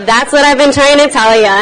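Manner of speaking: loud, high mock exasperation; exasperated punchline. Man in a joke finally making his point, exasperated.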